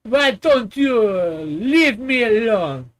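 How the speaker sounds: slow, hammed-up French accent; fake French accent. Telling them to leave him alone, mock French accent. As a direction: protest as a pretend Frenchman. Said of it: "I'll be speaking on the Frenchman".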